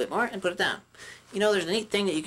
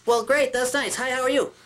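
higher, louder, gushing hello; overeager puppet greeting; 8 semitones higher, 4 dB louder than usual. Shown as a demonstration. Puppeteer demoing a bad puppet skit, gushing high. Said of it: "people start going like this".

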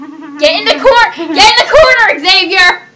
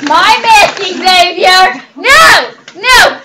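louder, higher scolding no; sharp scold; 3 semitones higher, 7 dB louder, slower than usual. Real, not acted. Owner scolding Xavier for taking his mask, loud. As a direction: stop the pet grabbing the mask.

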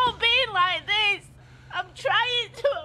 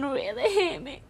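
normal talking voice

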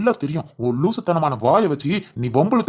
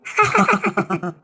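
chuckle; 3 semitones higher than usual. Acted. Uncle laughs at aunt's retort.

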